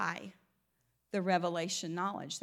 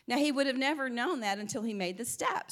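ordinary delivery

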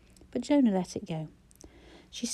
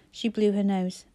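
ordinary delivery, nothing marked

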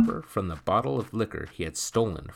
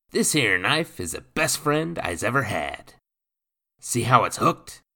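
lightly voiced gruff pirate, a touch louder; rough pirate voice; 6 semitones higher, 7 dB louder than usual. A narrator's reading. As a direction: speak like a swaggering old sea rogue. Nathan shows knife.

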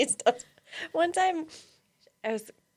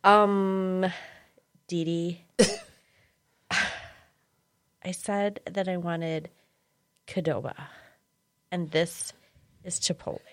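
slow, low, soft condescension; deadpan, measured disapproval; imitating sassy niece; 4 semitones lower, 4 dB quieter than usual. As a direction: spell out the mistake with long pauses. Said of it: "This is exactly how she sounded".

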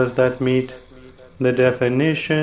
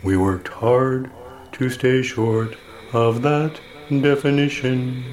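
ordinary talk

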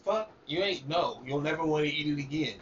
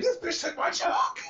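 indignant. Retold or imitated. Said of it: "was like".

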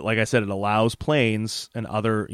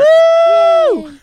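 excited whoop; 11 dB louder than usual. Real, not acted. Cheering the cartoon colonel.

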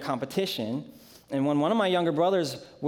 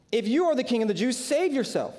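higher, quick jeering taunt; mocking soldiers; 7 semitones higher than usual. A narrator's reading. Soldiers mocking Jesus on the cross, quick jeer. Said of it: "Then the soldiers mocked him".

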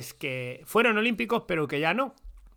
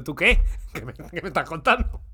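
Russian official; 4 dB louder than usual. Retold or imitated. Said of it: "me miraba el ruso diciendo".

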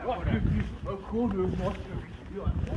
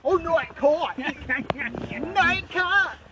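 much higher and louder mock outburst; hammed-up exclamation; 10 semitones higher, 6 dB louder, slower than usual. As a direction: shout it out theatrically in the accent, with a laugh. Answering himself in the accent.

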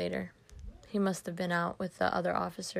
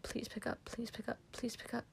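soft, higher, near-whispered begging; hushed pleading; 4 semitones higher, 10 dB quieter than usual. Acted. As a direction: plead under your breath into the phone. Trapped caller begs phone be answered.

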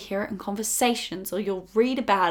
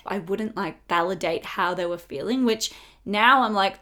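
ordinary talk

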